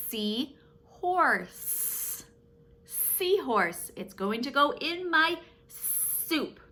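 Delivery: put-on bright, sing-song talk to a child; playful kids voice. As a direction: make it a fun game for kids.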